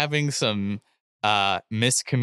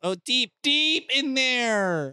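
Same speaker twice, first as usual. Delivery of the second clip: mock awe; 11 semitones higher, slower than usual. Mocking river depth.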